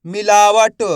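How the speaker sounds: मिलावट loud and measured, syllable by syllable; मिलावट sounded out with a released final ट; syllables blended. Shown as a demonstration. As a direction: blend मिलावट slowly for learners. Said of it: "तो मिलके बन जाएगा".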